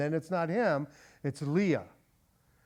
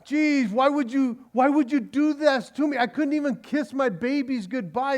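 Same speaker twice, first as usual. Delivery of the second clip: higher, louder whining; self-pity; 7 semitones higher, 4 dB louder than usual. Retold or imitated. Laban whining at Jacob, mock self-pity. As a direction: moan like a martyr. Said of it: "poor me".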